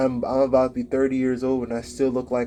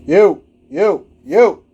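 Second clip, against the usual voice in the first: sudden loud yo, much higher and louder; sharp loud yo to get someone's notice; calling out; 7 semitones higher, 12 dB louder, much slower than usual. Real, not acted. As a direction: call across the room to grab attention. Breaks off to call someone.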